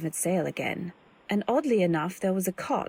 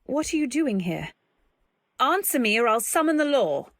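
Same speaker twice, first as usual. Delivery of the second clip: loud frightened demand; 4 semitones higher, 3 dB louder than usual. Acted. Threatening stranger with gaff hook.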